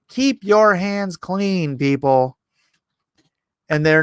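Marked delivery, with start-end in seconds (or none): 0.1-2.4 slow scolding. Real, not acted. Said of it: "lecturing viewers".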